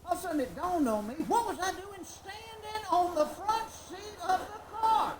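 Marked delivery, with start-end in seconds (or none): 1.3-5.2 higher, louder, slow; alarmed realization. Real, not acted. Comic realizing he stood on the front seat, loud and slow. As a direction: let it dawn on you in shock.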